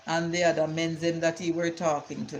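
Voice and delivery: ordinary talk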